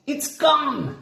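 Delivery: very high panicked cry. A narrator's reading. Quasi finding the seaweed gone, high panicked cry. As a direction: cry out the loss, pitched high.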